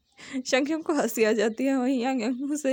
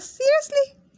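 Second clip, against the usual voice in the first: much higher, louder squeal through laughter; laughing disbelief; 5 dB louder, much slower than usual. A narrator's reading. Wing watching the rejected proposal, laughing loudly. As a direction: crack up while asking it. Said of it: "पेट पकड़कर हंसते हुए कहता है".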